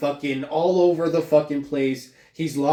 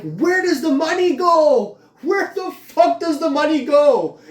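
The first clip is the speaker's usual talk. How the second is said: much higher, louder, slow outraged yell; angry shout; 10 semitones higher, 5 dB louder than usual. Retold or imitated. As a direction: demand it at the top of your voice. Furious rant about lost benefits.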